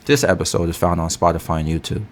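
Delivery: ordinary delivery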